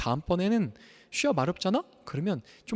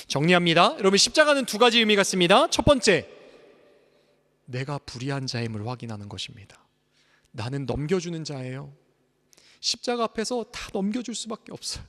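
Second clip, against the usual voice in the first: louder, higher summary points; forceful preaching; 6 semitones higher, 8 dB louder than usual. Acted. Pastor summarizing the sermon, forceful. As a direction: proclaim each point firmly.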